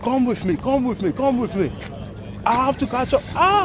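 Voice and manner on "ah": excited yelp. Real, not acted. Rushing to catch up.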